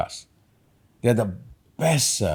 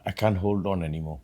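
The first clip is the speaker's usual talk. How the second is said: softer, lower, understated last words; dying man's apology; 4 semitones lower, 9 dB quieter than usual. Retold or imitated. Dying husband apologising to his wife, understated and quiet. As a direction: let it sink like failing strength. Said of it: "He is dying and he's telling his wife".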